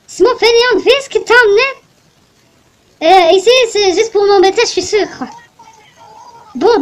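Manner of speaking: put-on old mother demanding a souvenir; grumpy old lady. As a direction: nag like a cranky granny. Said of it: "Je vais lui faire un bon accent".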